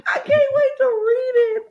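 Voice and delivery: very high, loud squeal; thrilled squeal. Retold or imitated. Fan echoing the contestant's line in a squeal. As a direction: shriek it with delight. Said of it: "I was like".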